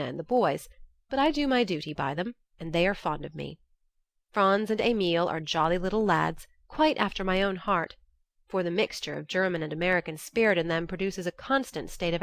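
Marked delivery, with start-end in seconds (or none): none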